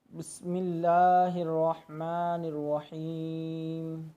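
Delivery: slow recitation ending on a silent मीम; slow tajweed recitation. Shown as a demonstration. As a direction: chant it slowly, stopping on the मीम. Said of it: "मीम को साकिन कर देना है". (धीमी तिलावत, मीम साकिन)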